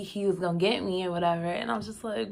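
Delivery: ordinary delivery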